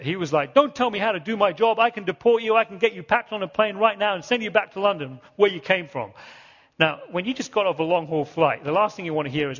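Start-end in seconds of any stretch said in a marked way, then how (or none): 0.5-6.1 retold angry outburst, higher and louder; losing his temper. Officer threatening to deport a traveller, mock angry outburst. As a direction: imitate an officer snapping into a tirade. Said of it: "that is when he lost it".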